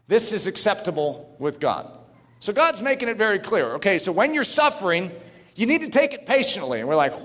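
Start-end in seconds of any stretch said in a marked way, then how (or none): none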